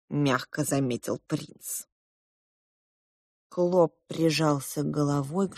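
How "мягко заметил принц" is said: ordinary delivery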